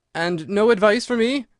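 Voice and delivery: high, loud plea with the voice cracking; breaking voice. Acted. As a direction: beg desperately as the voice breaks. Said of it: "in a breaking voice".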